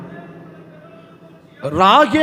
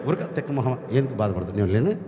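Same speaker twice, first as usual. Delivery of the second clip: lower, softer, tender scolding; gentle as a man; 8 semitones lower, 7 dB quieter, much faster than usual. Acted. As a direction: comfort her like a close friend. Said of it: "ఆ అమ్మతో అది అంటున్నాడు". (దేవుడు రాహేలును మనిషిలా మృదువుగా ఓదార్చడం)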